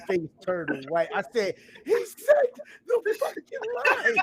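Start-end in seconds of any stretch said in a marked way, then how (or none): 1.8-4.2 high, loud and slow, near tears of joy; thrilled disbelief. Retold or imitated. Telling friends how Skeletor stunned him, high and slow. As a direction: stretch it out in giddy disbelief.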